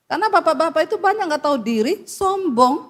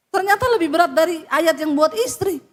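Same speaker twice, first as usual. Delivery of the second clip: usual speaking voice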